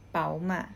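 "เป๋าหม่า" said as Mandarin bao-ma with rising tones; "เป๋าหม่า" in Chinese tones, not Thai; Mandarin reading. Shown as a demonstration. Teacher showing the Chinese name for BMW, Mandarin tones. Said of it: "คนจีนอ่านว่า".

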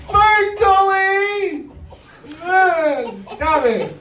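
high hammed-up wailing shout; hammed-up high cries about polar bears; mock wailing. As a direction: wail like a distraught kid, slowly. Mocking crying Greta.

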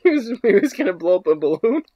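ordinary talk, nothing marked

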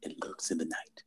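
low, soft movie trailer narrator; horror trailer voice. Pretend. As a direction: murmur it like a scary trailer.

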